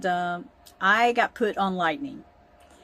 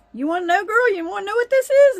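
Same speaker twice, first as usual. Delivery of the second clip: much higher, louder, fast teasing grandpa; 10 semitones higher, 6 dB louder, faster than usual. Retold or imitated. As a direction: tempt the kid, quick and loud. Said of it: "he goes".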